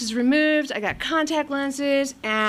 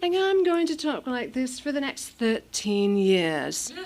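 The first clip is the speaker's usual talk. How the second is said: English accent. Shown as a demonstration. Demonstrating her fake English accent to the audience. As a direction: show how she talked as an English girl. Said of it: "I'm gonna speak with an English accent".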